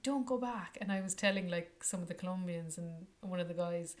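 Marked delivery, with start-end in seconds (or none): 0.0-0.8 higher, louder urgent plea. Retold or imitated. Begging the Finnish girl to stay, urgent plea. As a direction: beg her not to go. Begging friend not to leave.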